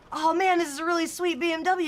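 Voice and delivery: high, loud hyped-up interviewer; mock interviewer voice. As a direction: play an eager video host. Imitating video interviewer.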